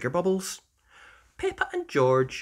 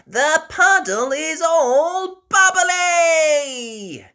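high, loud, slow shouted amazement; amazed gasp; 10 dB louder, much slower than usual. Acted. Peppa discovering the bubbly puddle, loud and amazed. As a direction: marvel out loud. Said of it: "gasps Peppa".

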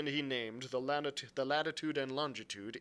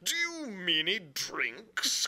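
higher, louder, drawn-out question; shocked cry; 10 semitones higher, 7 dB louder, much slower than usual. A narrator's reading. Squire asking about the first mate, shocked. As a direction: cry it out aghast, stretching the words. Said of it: "cried the squire".

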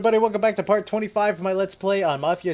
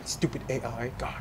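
ordinary delivery

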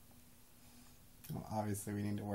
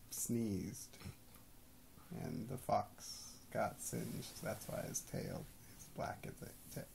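bedtime story narration; 7 dB quieter than usual. Acted. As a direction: drop your voice and hush it like a child is falling asleep.

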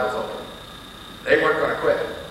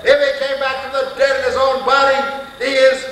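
high and loud, each clause climbing to a blow; confronting the Jehovah's Witness at full voice; fiery preaching; 10 semitones higher, 7 dB louder than usual. Acted.

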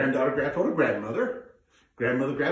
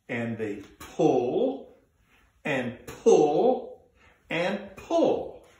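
each pull drawn out, rising in effort; straining pull; much slower than usual. Shown as a demonstration. Storyteller showing kids how to tug on the turnip. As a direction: heave the word pull like tugging a rope. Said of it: "Can you do that with me?".

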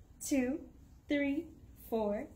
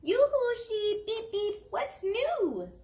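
calling out; 8 semitones higher than usual. Acted. Mother reading the picture book, calling out to the sheep. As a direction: call to the sheep across the farm.

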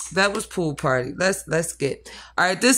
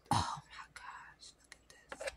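awed whisper; 19 dB quieter than usual. Real, not acted. Awed by the brush.

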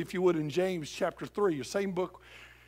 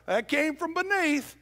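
preaching loud; 9 semitones higher, 5 dB louder than usual. Retold or imitated. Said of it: "He said".

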